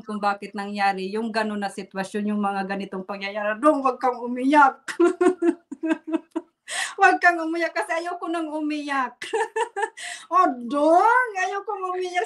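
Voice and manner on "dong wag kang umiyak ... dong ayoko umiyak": teary half-laugh, voice catching, a bit higher; laughing through tears. Real, not acted. Telling host she doesn't want to cry, teary laugh.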